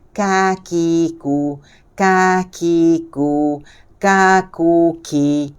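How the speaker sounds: "kakiku" in long, even, level syllables; low istifal kaf. Shown as a demonstration. Teacher drills thin kaf, slow chanted "kakiku". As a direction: draw out each syllable of "kakiku" slowly.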